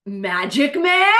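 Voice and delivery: slow, loud, rising disbelief; shocked recognition. Acted. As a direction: call the name out in astonishment. Acts out spotting her date.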